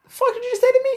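high, loud outraged squawk; hammed-up affronted yell; mock outrage. Speaker imitating an outraged reaction.

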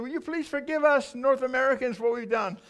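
high-pitched begging, re-enacted; retold tearful plea. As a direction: plead as if weeping at their feet. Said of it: "crying, saying, I remember I knelt".

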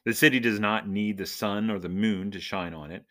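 ordinary talk

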